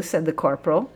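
plain reading voice

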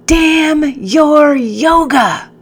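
slow, drawn-out high howl; anguished scream. Acted. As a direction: scream it out, stretched and broken. Captain screams, breaking down.

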